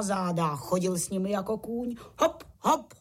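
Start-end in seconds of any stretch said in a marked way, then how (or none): 2.2-2.9 "Hop, hop" called in time, higher; two bouncy beats, pitched up; rhythmic call. A narrator's reading. Pytlík plays horse for the little crickets, calling in rhythm. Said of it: "říkal cvrčíčkům do taktu".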